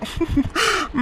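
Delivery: high, soft chuckle; gloating chuckle. Acted. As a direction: snicker softly at a secret scheme. Villain gloats over his scheme.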